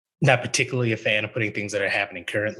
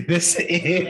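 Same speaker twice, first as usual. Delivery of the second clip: laughing at herself; 9 semitones higher, 3 dB louder than usual. Real, not acted. Forgot the word.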